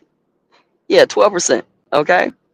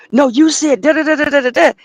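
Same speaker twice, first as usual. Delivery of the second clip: mock dispatcher rant; 12 semitones higher, 3 dB louder than usual.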